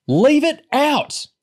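high, loud, furious scream; angry scream. A narrator's reading. Girl furious at friend's pointless fight, screaming. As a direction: scream at them to stop. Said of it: "she screamed".

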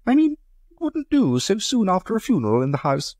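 high, light society lady asking; Amanda's voice. Acted. Amanda objects.

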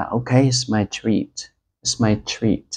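very slow "it's my treat", stress on "my"; slow model phrase. Shown as a demonstration. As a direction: offer to pay, slowly and clearly. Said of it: "mình có thể nói là". (chậm rãi mời khách, nhấn vào "my")

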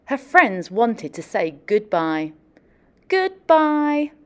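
picture book story narration; storybook reading. Acted. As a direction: tell it gently to a class of kids. Reads picture book aloud.

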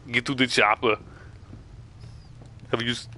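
a hint of Schwarzenegger's accent; lightly voiced Terminator line; Terminator impression. Gamer quoting Terminator after sounding like him, light accent. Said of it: "impression of the Terminator".

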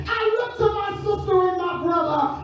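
loud, sung-out preacher cadence; preaching at full force. Acted. Preacher rousing the congregation, loud sung-out cadence. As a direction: lift the church with rising, chanted preaching.

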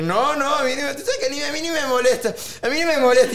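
tono mucho más agudo de falsa indiferencia; negando enojo. Retold or imitated. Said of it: "cuando la persona está brava".

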